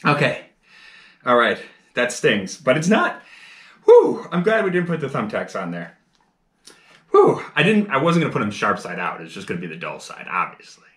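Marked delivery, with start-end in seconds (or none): 3.8-4.3 high relieved whoosh. Real, not acted. Relief after the pain. 7.1-7.4 high relieved whoosh. Real, not acted. Relief after the pain.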